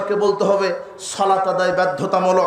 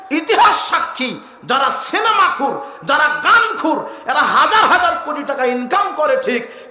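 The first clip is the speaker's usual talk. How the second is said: impassioned warning; 6 semitones higher than usual. Real, not acted. Warning against singers and film addicts.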